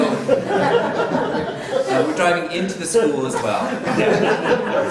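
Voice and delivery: laughing loudly while reading it out. Real, not acted. Teacher laughing at a student's wrong answer, loud laughing. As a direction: crack up as you read the silly answer.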